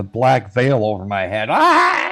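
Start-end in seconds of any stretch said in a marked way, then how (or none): 1.5-2.1 high hammed-up grief cry; mock wail. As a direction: throw out an overdone funeral wail. Wailing at a funeral.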